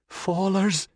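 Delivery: high, frightened outcry; terrified cry. Acted. Cassandra to the doll man, high terrified cry. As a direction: cry out in horror. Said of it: "she cried".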